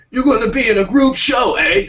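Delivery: high-pitched, hammed-up British old man; badly faked British accent. Old man in alley offering a show, poor British accent. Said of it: "I can't do accents".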